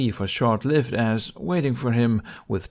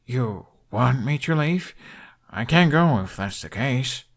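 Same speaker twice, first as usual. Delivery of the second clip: mock bashful; 3 semitones higher, 3 dB louder than usual. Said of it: "appearing bashful".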